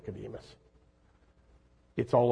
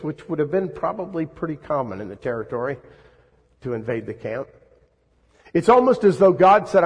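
usual speaking voice

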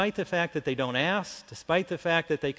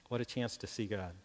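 normal talking voice

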